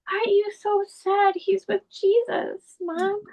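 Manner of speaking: put-on high, slow, gentle child's voice; imitating her little son. Mother quoting her six-year-old son, in a high slow child voice.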